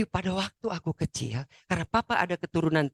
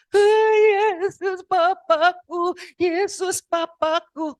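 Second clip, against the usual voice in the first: very high, loud, drawn-out cry; wailing to Jesus; 12 semitones higher, 7 dB louder, much slower than usual. Retold or imitated. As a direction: re-enact crying out to a found father. Acts out overwhelmed cry to Jesus. (tangisan melengking panjang memanggil Yesus)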